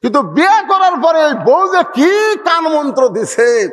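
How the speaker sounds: high, loud preacher's cry; preaching shout. Real, not acted. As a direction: thunder it out at the crowd. Preacher decrying the wife. (উচ্চস্বরে ওয়াজের চিৎকার)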